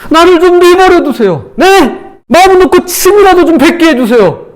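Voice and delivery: high-pitched, exasperated plea to be left alone; Job protesting. Acted. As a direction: act out a fed-up outcry to God. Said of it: "이게 욥이 한 말이에요".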